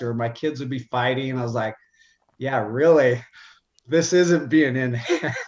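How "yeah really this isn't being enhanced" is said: louder, laughing sarcastic retort; sarcastic laughing scoff. Retold or imitated. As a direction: scoff at the idea through a laugh. Angry at doctor, laughing retelling.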